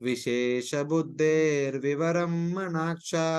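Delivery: steady scriptural chant; Sanskrit verse recitation. Acted.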